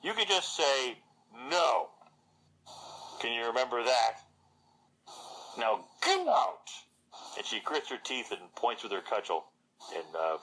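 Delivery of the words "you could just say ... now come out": scornful Agatha. Acted. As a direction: spit it out with a screwed-up face. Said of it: "screws her face up and goes".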